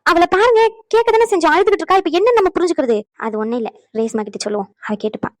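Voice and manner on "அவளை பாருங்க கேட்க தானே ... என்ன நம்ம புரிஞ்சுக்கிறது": high, loud, exasperated pleading; agitated protest. Acted. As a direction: protest to your mother-in-law at raised pitch. Agitated protest to mother-in-law.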